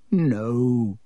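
dismayed, croaky no; strained, drawn-out refusal; croaked. Acted. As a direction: croak it out in dread. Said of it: "croaked Harry".

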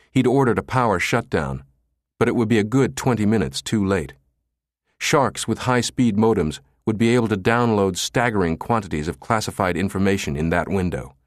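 ordinary delivery, nothing marked

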